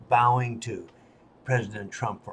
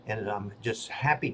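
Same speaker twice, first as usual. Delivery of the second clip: ordinary delivery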